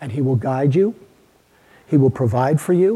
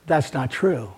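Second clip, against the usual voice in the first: normal talking voice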